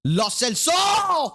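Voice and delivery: a long, high, drawn-out real yell of the name; excited shout. Real, not acted. Streamer yelling at a goal-bound shot, real excited shout. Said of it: "I'm so sorry यार चिल्लाने के लिए ऐसे".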